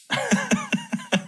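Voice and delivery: a high, breathy little laugh. Real, not acted. Laughing after story ends.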